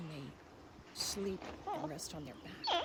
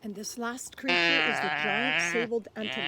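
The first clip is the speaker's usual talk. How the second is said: big announcement; 11 dB louder than usual. Acted. Announcing the last animal.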